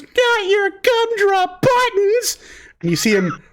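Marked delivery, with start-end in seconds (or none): 0.0-2.4 very high, drawn-out squeaky wail; hammed-up cookie horror, stretched slowly; horrified gingerbread man. Gingerbread man horrified at his buddy's sacrifice, wailing.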